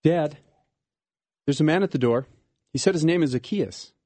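put-on a small girl calling her dad; child's voice announcing a visitor; young daughter.

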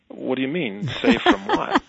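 a mock-incredulous squawk of confusion; baffled. Baffled reply to friend's news.

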